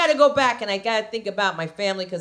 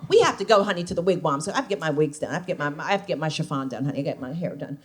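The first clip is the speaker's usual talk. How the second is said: lower, softer sassy salon voice; 8 semitones lower, 5 dB quieter, faster than usual. Retold or imitated.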